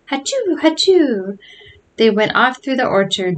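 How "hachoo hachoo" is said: two high-pitched fake achoos; mock sneezing. A narrator's reading. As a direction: pretend sneezes that fall away at the end. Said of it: "Joe sneezing".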